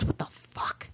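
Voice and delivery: a shocked whisper under the breath; hushed, voiceless swear; whispered disbelief. Retold or imitated. Disbelief while being shot at.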